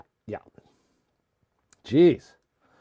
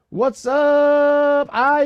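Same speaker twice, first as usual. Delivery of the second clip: long, high-pitched holler of greeting; calling out; 7 semitones higher, much slower than usual. Real, not acted. Streamer hailing Eyes on arrival, called out high and long. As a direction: holler it out, stretching the words.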